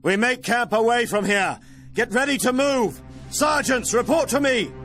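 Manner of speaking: high, carrying military command; calling out orders. Acted. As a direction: call the orders across the camp. Commander calls orders to troops.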